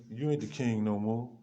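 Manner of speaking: normal talking voice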